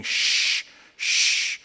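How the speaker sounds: drawn-out unvoiced "shh"; shushing. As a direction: shush them like a congregation at services. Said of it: "everyone's going".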